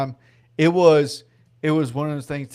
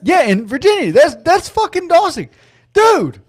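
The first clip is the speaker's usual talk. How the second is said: loud, very high-pitched disbelief; real astonishment bursting into excited shouts; amazed outburst; 6 dB louder than usual. Real, not acted. Host recognizing a long-lost friend in chat, amazed shouting.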